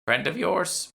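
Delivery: put-on high, wry old woman's question; a knowing old shopkeeper probing; curious old shopwoman. Shopwoman asking about Lisa, high and wry.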